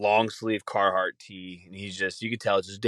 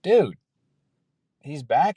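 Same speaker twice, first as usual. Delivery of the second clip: excited; 6 semitones higher, 5 dB louder, slower than usual. Real, not acted. Hopscotch guy is back.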